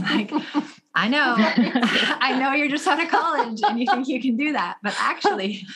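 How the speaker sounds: chuckling through the words; amused, laughing aside. Real, not acted. Laughs at student diet habits.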